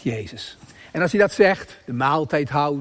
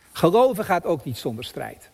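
ordinary talk, nothing marked

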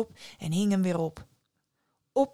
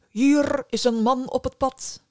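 raised, higher leader's call; 4 semitones higher, 5 dB louder than usual. A narrator's reading. Army leader warns the hill of a follower, calling out. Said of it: "riep de leider tegen de heuvel".

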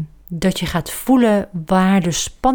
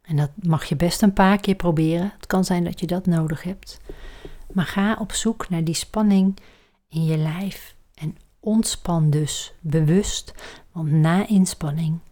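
breathy guided meditation voice; soft spa whisper; 4 dB quieter than usual. Acted. Leads a relaxation exercise.

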